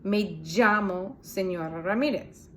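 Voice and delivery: llamo said with a j sound, "jamo"; Colombian ll. Shown as a demonstration. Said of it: "sound like a J".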